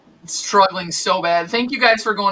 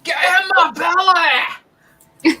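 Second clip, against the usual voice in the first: much higher, slow, shouted movie-villain line; Fat Bastard impression; 8 semitones higher, 3 dB louder, much slower than usual. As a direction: bellow it out slowly like a greedy brute. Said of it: "Is that fat bastard?".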